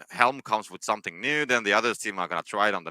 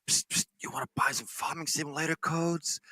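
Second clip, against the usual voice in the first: higher, much softer shady dealer; secretive raspy sales murmur; 7 semitones higher, 7 dB quieter than usual. Pretend. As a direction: hiss it like a back-alley seller. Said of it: "you walk in an alley".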